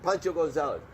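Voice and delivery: loud, high triumphant call-out; excited retold shout; shouted answer. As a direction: blurt the winning answer across the bar. Retelling his shouted trivia answer.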